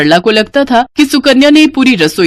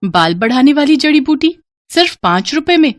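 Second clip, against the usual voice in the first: put-on higher, questioning young woman; curious girl; 5 semitones higher than usual. Sudha repeats the old man's offer, higher and curious. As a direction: echo the offer in disbelief.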